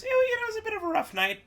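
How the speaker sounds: very high, rushed hedge; high-pitched, hurried downplaying; squeaky understatement. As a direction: squeak through it quickly, sugarcoating a bad night. Awkward understatement about a bad hostel.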